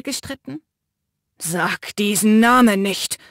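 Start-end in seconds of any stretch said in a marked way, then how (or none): 1.4-3.2 growled. Acted. Matteo growls at the narrator through the bars, slow and menacing. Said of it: "grollte Matteo".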